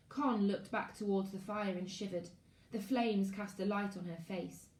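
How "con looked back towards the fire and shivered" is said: ordinary delivery, nothing marked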